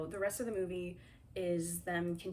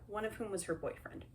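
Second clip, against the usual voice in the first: usual speaking voice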